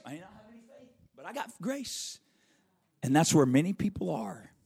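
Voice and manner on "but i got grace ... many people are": ordinary delivery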